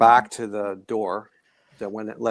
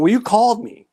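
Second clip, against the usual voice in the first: high, louder "Well, you called me", puzzled; baffled retort; 12 semitones higher, 6 dB louder than usual. Retold or imitated. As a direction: protest in baffled disbelief. Exasperated retort to son.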